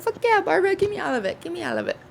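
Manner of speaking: high, eager yes to food; excited guest demanding everything; greedy excitement. Retold or imitated. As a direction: grab at the offer hungrily. Greedy reply to food offer.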